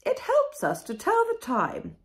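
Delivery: very high, loud posh voice; grand queen. A narrator's reading. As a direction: lecture like a pompous royal. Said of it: "said the Queen".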